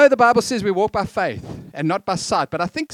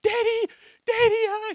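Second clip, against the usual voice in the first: put-on much higher excited toddler voice calling Dad; little daughter calling. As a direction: call out like a three-year-old girl at the gate. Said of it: "would be standing there flapping her arms".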